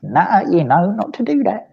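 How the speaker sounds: scolding the cat. Retold or imitated. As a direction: wag a finger at the cat with your voice. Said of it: "I'll be like".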